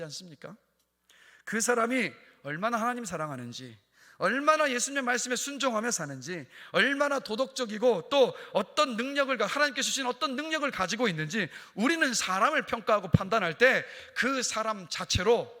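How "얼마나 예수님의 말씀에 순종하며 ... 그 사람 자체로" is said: loud, raised-pitch pulpit delivery; impassioned sermon voice; forceful preaching. Real, not acted. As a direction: drive it home to the congregation.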